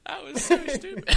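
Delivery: loud, high, laughing through words; real laughter. Real, not acted. Host breaking after silly prank sketch, real laughter. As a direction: crack up while speaking.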